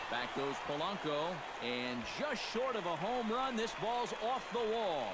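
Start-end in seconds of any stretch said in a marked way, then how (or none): 1.6-5.1 excited call. Acted. Near home run call.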